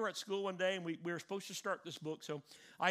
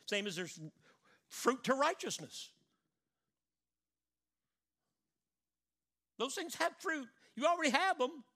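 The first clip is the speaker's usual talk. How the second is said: full-force preaching; 6 semitones higher, 5 dB louder than usual. Acted.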